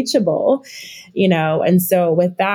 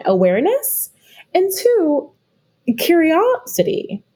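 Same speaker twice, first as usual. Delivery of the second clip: ordinary talk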